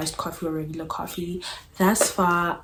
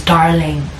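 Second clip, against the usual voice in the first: put-on British accent; 8 dB louder than usual. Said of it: "each time he says".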